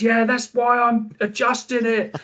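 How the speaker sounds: retold shouted reply, pitched well up; imitating shouting. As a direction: yell back over the club sound system, not at full volume. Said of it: "we were shouting at each other".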